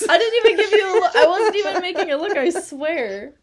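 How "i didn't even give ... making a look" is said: high, loud, rapid denial; laughing protest. Real, not acted. Co-host denying she tricked the player with a look, laughing protest. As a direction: protest your innocence through laughter.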